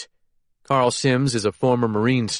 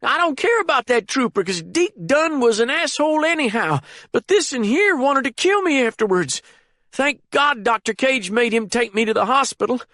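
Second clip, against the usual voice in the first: put-on high, panicked frightened old man; 3 dB louder than usual. A narrator's reading. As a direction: blurt it out in terror. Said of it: "in his panicked state".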